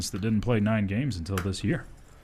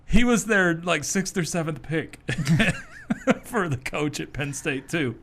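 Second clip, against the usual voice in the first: laughing; 6 semitones higher, slower than usual. Real, not acted. Laughing at the coaching hire.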